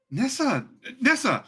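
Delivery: calling out. Acted. Echo calling out to Nessa.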